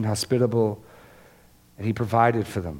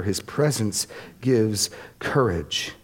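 slow, deliberate; slower than usual. Real, not acted. Preacher repeats a key line to the congregation, slow and deliberate. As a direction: lay each word down slowly.

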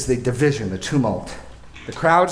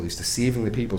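ordinary talk

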